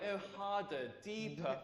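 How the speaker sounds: soft mock bedroom moan; hushed mock dirty talk. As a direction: imitate a lover's breathy urging, played for laughs. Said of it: "she started talking dirty back to me".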